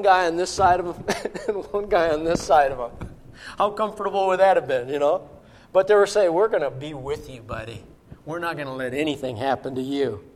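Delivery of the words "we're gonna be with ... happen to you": low, soft voice of a protector; reassuring. Retold or imitated. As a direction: comfort him quietly, as his guard. Retold companions reassure the guest.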